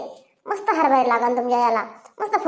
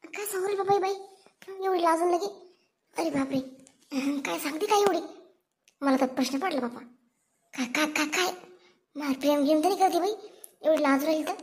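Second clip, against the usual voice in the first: muttered aside; 7 dB quieter than usual. Acted.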